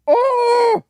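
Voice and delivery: high, loud burst of joy; shrieking with delight; ecstatic yelp. Real, not acted. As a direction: let out a thrilled cry. Team drafted wanted player.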